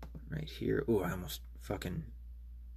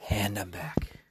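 whispered aside. Real, not acted. Returning after switching segments.

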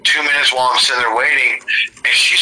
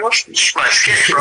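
high, slow, breathy homeboy impression of a compliment; lightly voiced homeboy asking about the kicks; imitating homeboys; 9 semitones higher than usual.

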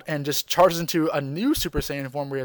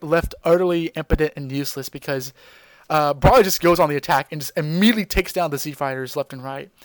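ordinary delivery

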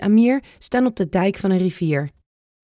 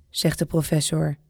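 ordinary delivery, nothing marked

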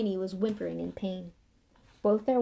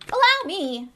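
loud, high eager shout; shouted offer; 11 semitones higher, 11 dB louder than usual. Acted. Lockhart jumping in at the snake, loud eager shout. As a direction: burst in loudly to take over. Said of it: "shouted Lockhart".

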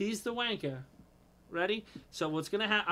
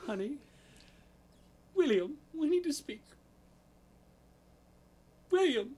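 a higher, prim British voice, lightly done; British girlfriend; 7 semitones higher than usual. Pretend. Girlfriend summoning her boyfriend William, prim British accent. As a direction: ask for William's attention like a fussy English lady. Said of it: "you have to do a British accent".

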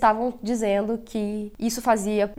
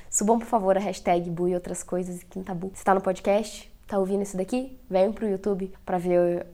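ordinary talk, nothing marked

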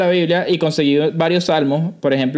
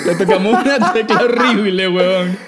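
laughing; slower than usual. Real, not acted. Teasing the co-host on camera, laughing as he speaks. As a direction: tease him through laughter.

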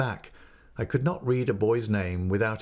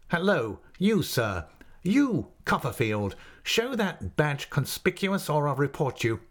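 mild mock roar; 5 semitones higher than usual. A narrator's reading. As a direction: call out with restrained bluster. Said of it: "he roared out from his lodge door in a stupendous voice".

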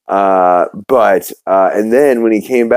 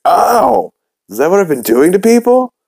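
high mock yelp of pain; yelp, then ask in pitched-up disbelief; pained surprise; 11 semitones higher than usual. [name] after stabbing himself in the stomach, pained surprise.

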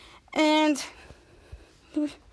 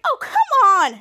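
high, loud mock whine of protest; acted exasperated outburst; 11 semitones higher, 8 dB louder than usual. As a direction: protest loudly, hammed-up. Character protests in retelling.

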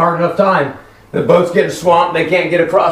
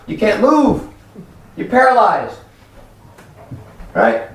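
usual speaking voice

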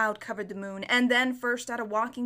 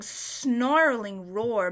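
ordinary delivery, nothing marked

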